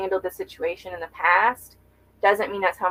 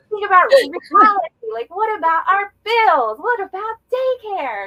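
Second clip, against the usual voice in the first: high, loud, slowed mock rant; reenacting scolding her husband about bills and daycare, high loud mock rant; exasperated scolding; 12 semitones higher, 7 dB louder than usual. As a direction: shriek the worries at him, much higher.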